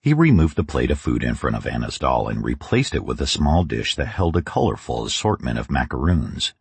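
ordinary delivery

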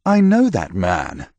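a knight recognizing Thomas, slow and lightly voiced. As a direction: slowly place the face. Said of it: "the knight said".

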